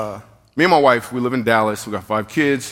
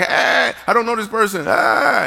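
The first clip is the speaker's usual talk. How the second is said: put-on baby protesting; 9 semitones higher than usual. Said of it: "what if the baby's like".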